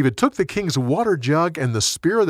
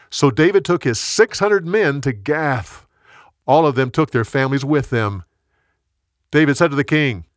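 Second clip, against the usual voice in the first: plain reading voice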